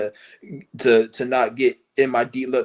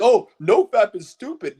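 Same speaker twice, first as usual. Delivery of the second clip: mocking quote; 5 semitones higher, 4 dB louder, slower than usual. Mocking wannabe deep people.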